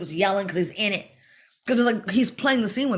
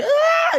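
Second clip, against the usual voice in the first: high loud scream; imitated scream; 6 dB louder than usual. Imitating the actor's scream, high and loud. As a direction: let out a sudden high scream. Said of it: "Kurt to be screaming like that".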